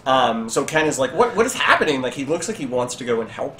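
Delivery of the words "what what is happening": higher, lightly acted bewilderment; flabbergasted. Town elder gaping at the captive, lightly flabbergasted. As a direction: stammer it in mild disbelief. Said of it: "he's just flabbergasted".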